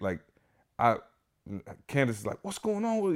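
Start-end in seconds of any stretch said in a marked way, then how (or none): 2.4-3.1 mock-panicked high voice; imitated panic. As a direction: imitate a startled wife starting to panic. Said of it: "she started panicking".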